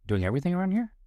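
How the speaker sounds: high curious upward inflection; rising mirror. Shown as a demonstration. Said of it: "a mirror works better".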